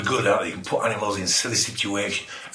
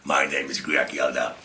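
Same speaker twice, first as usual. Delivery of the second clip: deep mystery man; 11 semitones lower, 5 dB quieter than usual. Pretend. Teacher voicing the mysterious Yeldar to pupils, a deep low voice. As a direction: introduce yourself as a strange low-voiced stranger.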